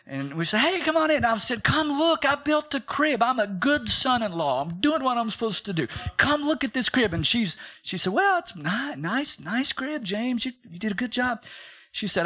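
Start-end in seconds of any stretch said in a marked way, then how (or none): 0.6-7.1 high, eager showing-off; proud son-in-law showing off his crib; bubbly, higher-pitched pride; excited. Retold or imitated. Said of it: "I'm so excited". 8.1-11.4 hesitant mother-in-law. Retold or imitated. Mother-in-law hesitantly praising crib.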